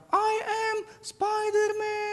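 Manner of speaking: put-on high, drawn-out little kid's cry; child's shout. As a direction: play a small boy yelling like a superhero, stretching it out. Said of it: "anak Jeremia berteriak".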